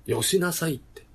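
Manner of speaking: high, loud mock Kansai retort; playful Kansai comeback; Kansai-style tsukkomi. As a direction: snap back like a manzai straight man. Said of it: "関西弁じゃん".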